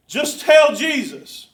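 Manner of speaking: slow, loud, drawn-out plea; loud pleading. Retold or imitated. Pastor urging Stephen to pray, loud and slow. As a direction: hammer each word home slowly. Preacher retelling his plea.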